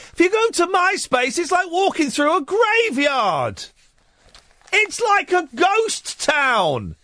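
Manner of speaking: high-pitched, incredulous comic outburst; exasperated rant. Acted. Host mocking a MySpace news story, exasperated rant. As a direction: rant with long, stretched line endings.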